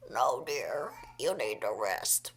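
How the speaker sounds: put-on very low old-woman voice; ancient old lady. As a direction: refuse like a kindly centenarian. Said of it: "she's, like, a hundred years old".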